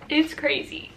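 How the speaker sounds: normal talking voice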